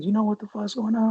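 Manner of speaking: a touch of urgent caller; voicing the caller. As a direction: pitch it up for the caller. Caller voiced in story.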